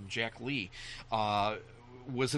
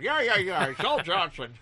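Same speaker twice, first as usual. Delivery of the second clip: loud, raised dad voice; dismissive dad; 8 semitones higher, 8 dB louder than usual. Retold or imitated. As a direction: brush the kid off loudly. Dad brushes off kid's correction.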